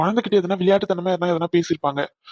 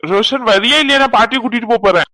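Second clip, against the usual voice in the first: loud, raised call across the room; sharp impatient summons; 6 semitones higher, 8 dB louder than usual. Acted. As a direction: shout for the boy to hurry. Impatient calling out to boy.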